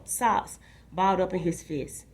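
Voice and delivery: usual speaking voice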